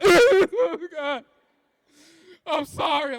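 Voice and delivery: high wailing apology; slapped teenager crying to his mom; mock crying. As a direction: blubber a sorry. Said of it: "I started crying immediately".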